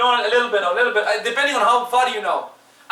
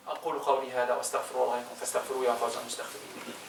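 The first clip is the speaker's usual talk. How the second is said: quick, soft ritual Arabic recitation; khutbah formula recitation; 9 semitones lower, 14 dB quieter, much faster than usual. Acted. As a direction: rattle off the sermon formula under the breath. Recites closing and opening khutbah formulas.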